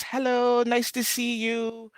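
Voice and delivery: sing-song hello. Pretend. Sing-song greeting shout-outs.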